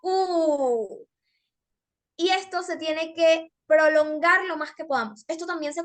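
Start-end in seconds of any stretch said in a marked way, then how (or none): 0.0-1.1 long, falling, aching "oooh"; pained "oo". Shown as a demonstration. Teacher demonstrating an emotive vowel, very pained drawn-out U. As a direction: stretch the U into a pained moan. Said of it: "como muy dolorosa".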